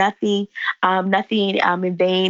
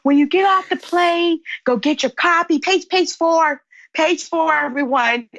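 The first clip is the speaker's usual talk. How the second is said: mock announcement to the plane; 8 semitones higher than usual. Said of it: "he yells to the plane".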